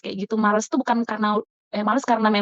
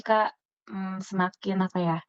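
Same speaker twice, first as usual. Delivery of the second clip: normal talking voice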